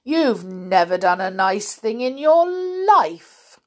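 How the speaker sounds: loud, slowed reproach; mildly theatrical outrage; indignant scolding. Acted. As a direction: scold it out, stretching the end. Elephant scolds the crocodile.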